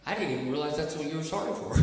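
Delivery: fast, low, offhand; quick surprised reply. Retold or imitated. To the coworker who kept apologizing, fast and offhand. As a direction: rattle it off, just realizing. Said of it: "And I said".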